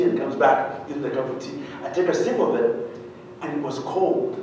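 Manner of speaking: ordinary talk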